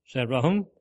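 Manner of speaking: usual speaking voice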